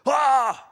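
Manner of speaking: loud startled gasp on waking; rudely awakened. Acted. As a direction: jolt awake with a sharp Ha. Boas wakes up with a start.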